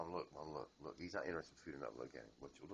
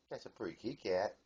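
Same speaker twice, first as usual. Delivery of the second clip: ordinary talk, nothing marked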